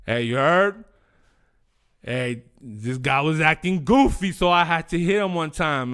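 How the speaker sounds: put-on a light, playful New York accent; tough-guy New York accent, half joking. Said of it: "I wanna talk like I'm from New York".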